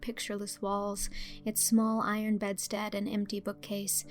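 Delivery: ordinary delivery, nothing marked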